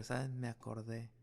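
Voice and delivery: ordinary talk, nothing marked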